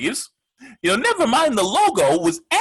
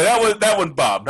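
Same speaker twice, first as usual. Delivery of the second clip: usual speaking voice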